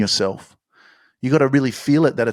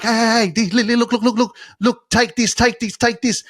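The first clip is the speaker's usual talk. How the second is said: much higher, urgently pushing the words on them; a hammed-up eager friend begging them to look; mock eager pleading; 10 semitones higher than usual. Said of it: "You're like".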